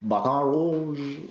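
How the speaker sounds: exaggerated French pronunciation; French accent. Shown as a demonstration. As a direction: have a go at French, stretching it out. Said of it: "how to do the French accent".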